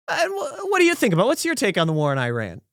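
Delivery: high-pitched stumbling start, dropping to offhand; flippant. Retold or imitated. Diner springs a political question on the server, flippant. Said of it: "I just flippantly threw it to you".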